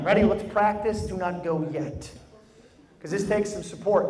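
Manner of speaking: ordinary talk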